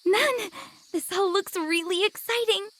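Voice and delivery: high, bright-eyed eagerness; eager. Acted. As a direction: answer like a keen new student. Eager novice answering the nun.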